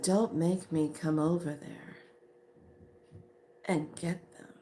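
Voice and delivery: softly spoken mock threat; calm threat. Woman threatening to come over to her friend, quietly menacing. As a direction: threaten quietly, pausing before the last words.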